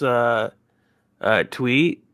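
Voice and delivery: usual speaking voice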